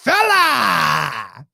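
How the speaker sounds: loud, long falling bellow; shouted catchphrase. Acted. Mimicking Sheamus's catchphrase.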